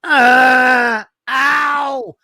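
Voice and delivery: loud, high, drawn-out groan; pained groan. Shown as a demonstration. Husband showing wife what pain sounds like, groaning. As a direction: demonstrate an unmistakable sound of pain. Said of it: "there's certain sounds that people make".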